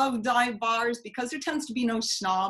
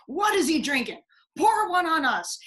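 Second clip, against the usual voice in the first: higher, louder hearty biker shout; rowdy bikers calling out; 7 semitones higher, 7 dB louder than usual. Retold or imitated. Bikers welcoming the grieving man with a loud hearty call. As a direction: boom it out like a rowdy Viking gang. Bikers crowd round the mourner.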